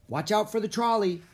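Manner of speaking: high-pitched warning call; urgent warning. A narrator's reading. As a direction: cry out as a trolley nears. Warning while crossing busy street.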